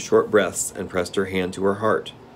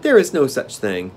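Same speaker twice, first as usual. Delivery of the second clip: put-on little boy voice; 5 semitones higher, 5 dB louder than usual.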